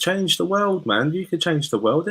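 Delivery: excited. Real, not acted. Rallying listeners to act.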